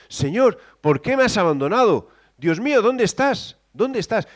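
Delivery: high, loud anguished plea; Jesus forsaken on the cross, anguished; anguished cry. Acted. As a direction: cry out to God in agony. Voicing Jesus crying out.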